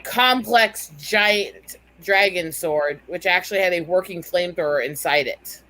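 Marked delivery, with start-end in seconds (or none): none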